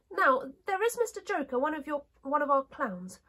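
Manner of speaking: high princess voice, chatting; china princess. Acted. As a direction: introduce someone in a high doll-like voice.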